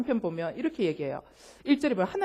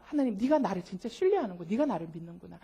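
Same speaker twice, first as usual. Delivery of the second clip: softer, lower gentle approval; God tenderly answering the honest believer; 5 semitones lower, 7 dB quieter than usual. Retold or imitated. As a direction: tell them tenderly you are trusted.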